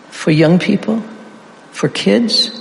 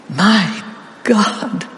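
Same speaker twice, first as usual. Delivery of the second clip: anguished cry; 3 dB quieter than usual. Acted. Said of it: "Jesus himself cried out and said".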